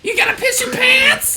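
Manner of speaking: high shrill taunting shout; Toad voice. Acted. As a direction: yell it like a squeaky toad. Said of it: "do the fucking Toad voice".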